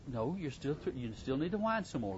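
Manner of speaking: normal talking voice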